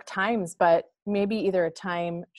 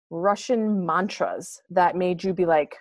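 normal talking voice

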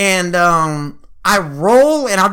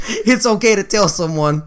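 normal talking voice

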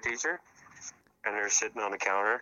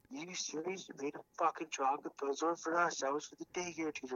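high, softened mock scolding; retold mad partner; 8 semitones higher, 4 dB quieter than usual. Caller mimics partner scolding him over chocolates, mock angry. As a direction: imitate an indignant telling-off in a raised pitch. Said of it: "And she was so mad".

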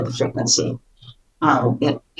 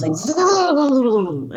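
stretched, very high buzzing voice; 9 semitones higher than usual. Shown as a demonstration. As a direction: imitate a shrill energy buzz. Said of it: "it was so high".

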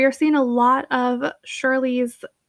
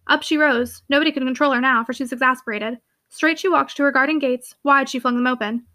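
ordinary delivery

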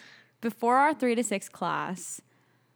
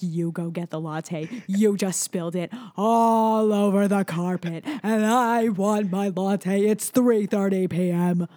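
retold upset demand; 3 dB louder than usual. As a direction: replay the demand with hammed-up irritation. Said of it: "it made me so upset".

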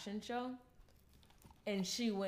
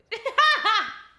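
loud high giggle; high burst of laughter; 15 dB louder than usual. Real, not acted. Giggling at own joke.

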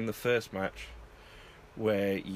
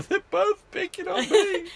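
high, loud mock wail; hammed-up tearful whine; mock crying; 9 dB louder than usual. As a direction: imitate a wailing complaint, pitched way up. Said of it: "like, sort of cry thing".

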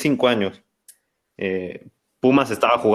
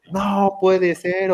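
much higher, drawn-out broadcaster cry; mock TV commentator; 10 semitones higher, much slower than usual. Imitating the TV commentator after the tying shot, hammed-up. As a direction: ham up a stunned sports announcer.

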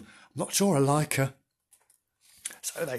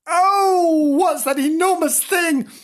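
high, loud kitten squeal of alarm; frightened mew; 14 semitones higher, 7 dB louder, slower than usual. A narrator's reading. Kitten seeing a motor car race by, frightened. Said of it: "Bernard mewed in fright".

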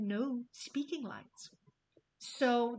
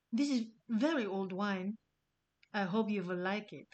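put-on Dracula accent. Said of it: "Just go with me on my shitty accent".

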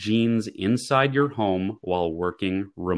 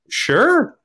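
eager sure; 7 dB louder than usual. Retold or imitated. Eager reply to phone offer.

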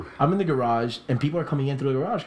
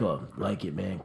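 put-on low, lazy drunken mumble; a hint of a drunk partygoer praising the art; drunk guy; 6 semitones lower, 6 dB quieter than usual. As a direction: mumble it like you've had too many. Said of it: "This guy's drunk out of his fucking ass".

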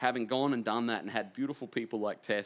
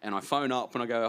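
ordinary delivery

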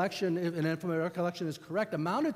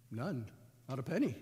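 sheepish low answer; 4 semitones lower, 6 dB quieter than usual. Acted. Criminal admits, low and soft.

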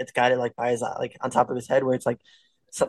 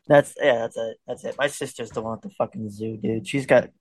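usual speaking voice

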